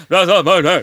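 loud, high insistent no; emphatic refusal. Retold or imitated. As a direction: shut the question down firmly. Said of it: "I just immediately went".

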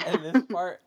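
high laugh into loud words. Real, not acted. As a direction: laugh through it. Laughing loudly.

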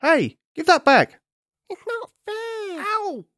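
arguing monkeys. Acted. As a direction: bicker like squealing young monkeys. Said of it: "voices arguing".

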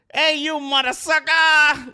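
drawn-out high shout across a restaurant; fan yelling. Retold or imitated. Fan in a restaurant yelling at the host, loud slow shout. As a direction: yell at him like a rowdy fan. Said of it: "you hear a guy screaming in the background".

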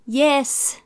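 high-pitched sudden confession; blurted. A narrator's reading. Boy cracks and confesses to Dad, blurting. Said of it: "blurted Eric".

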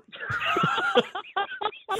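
high-pitched burst of laughter. Real, not acted. Host joking about a backup career, real high laugh. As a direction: crack up at your own joke. Host cracks up at his joke.

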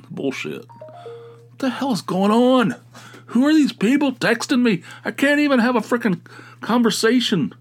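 high-pitched exasperated outburst; real irritation. Real, not acted. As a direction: erupt at a maddening interruption, voice way up. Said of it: "my God, irritating".

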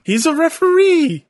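high, loud protest; indignant exclamation. Real, not acted. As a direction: call it out in loud disbelief. Exclaiming back at the match.

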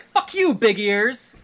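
sudden high loud yell; sudden shout. Retold or imitated. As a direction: yell it as a rude punchline. Punchline, girl's retort.

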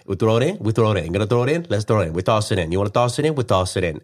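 Italian game-show patter, bouncing up and down; Italian accent. Retold or imitated. Husband as packing mover showing items to his wife, Italian accent. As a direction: rattle it off with a playful Italian accent. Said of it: "It was an Italian game show".

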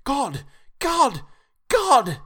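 high, loud desperate cries of God; a madman shouting God, rising in panic. A narrator's reading. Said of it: "Renfield had called out loudly several times".

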